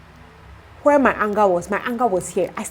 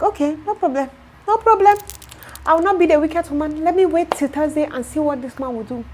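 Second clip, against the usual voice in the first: higher, sugary put-upon okay; mock agreement; 7 semitones higher than usual. To her friend about the carpenter, higher mock-sweet agreement. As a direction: grudgingly play the patient woman. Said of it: "So I'm like".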